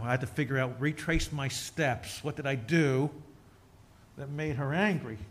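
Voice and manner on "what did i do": ordinary talk, nothing marked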